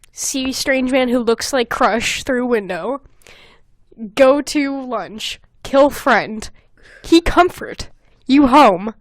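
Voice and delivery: slow, choppy broken-English recap; mock-simple slow recap; slow caveman summary. Shown as a demonstration. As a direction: spell it out slowly like a caveman. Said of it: "here's a walk through".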